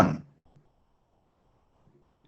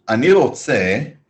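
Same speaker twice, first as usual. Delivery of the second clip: "ani rotze" drawn out slowly; slow model answer; 2 semitones higher, 4 dB louder, much slower than usual. Shown as a demonstration. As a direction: give the learners time to follow. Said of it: "say it for him".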